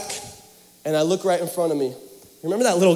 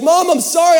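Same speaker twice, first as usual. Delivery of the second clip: panicked apology; 9 semitones higher, 7 dB louder than usual. Retold or imitated. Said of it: "I was in a panic".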